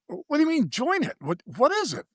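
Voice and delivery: high-pitched, puzzled questioning; retold bafflement. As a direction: act out being thrown by the suggestion. Retells his baffled reply to friend.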